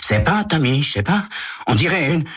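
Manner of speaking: put-on high boyish voice, unsure; fumbling for an answer; puzzled teen. Walt feels the thing on the floor, high and unsure.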